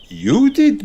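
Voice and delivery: high lilting teacher voice; light singsong reply; Madame Gazelle voice. A narrator's reading. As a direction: answer brightly like a teacher. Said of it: "says Madame Gazelle".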